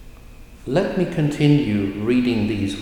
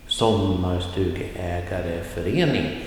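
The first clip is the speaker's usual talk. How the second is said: Sommarstugeägareförening, falling start, flat middle, lift on förening; rising at the end; 3 semitones lower than usual. Shown as a demonstration. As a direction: keep Sommarstugeägareförening level until the late rise. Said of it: "And rising at the end".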